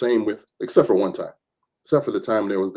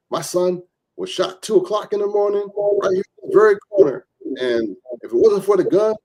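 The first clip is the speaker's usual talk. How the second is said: higher, lightly voiced grieving mother; grieving mother testifying; 9 semitones higher, 4 dB louder than usual. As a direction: imitate a mother pleading at a hearing, higher pitch. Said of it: "this lady was testifying".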